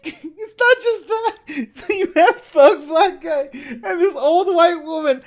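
high-pitched laughing through the words; can barely get it out for laughing; laughing hard. Real, not acted. Describing the ridiculous shootout, laughing hard.

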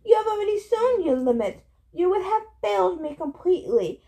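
high-pitched monster scorn; scornful. A narrator's reading. Monster puts down its boasting servant, scornful. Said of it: "the monster said in scorn".

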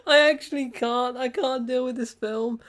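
high, loud, overcome with laughter; helplessly amused. Real, not acted. Reviewer who can't deal with the film, helplessly amused. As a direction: give up, cracking up. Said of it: "I did not think this would be that funny".

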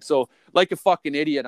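ordinary talk

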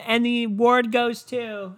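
high, slow announcement; hammed-up awards-show suspense; mock award presenter. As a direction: stretch out the reveal in a put-on presenter voice.

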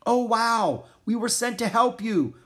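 high, loud exclamation; excited cry. A narrator's reading. Annie meeting the famous musher, excited cry. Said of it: "cried Annie".

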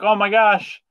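hammed-up joyful recognition. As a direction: burst out as it clicks. Dory remembering, voiced.

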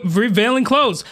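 ordinary talk

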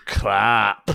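put-on Scottish accent, punched out; gruff go at a Scottish accent; Fat Bastard. Retrying the request for his co-hosts, Scottish accent. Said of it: "in the accent of fat bastard".

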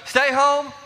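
high, ringing "Stay home" as the call; call and response. Shown as a demonstration. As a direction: give the cue line for the response. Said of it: "I'll say stay home, then you say stay put".

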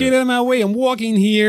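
very high, loud New York holler; hammed-up Queens street shout; mock Queens accent. Shown as a demonstration. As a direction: yell it like a caricature New Yorker. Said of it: "go proper, like, Queens".

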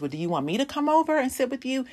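high-pitched, gently offering friend; concerned friend. Acted. Friend offers to come sit with you, concerned. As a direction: pitch it up as a caring friend asking. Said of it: "If that person says".

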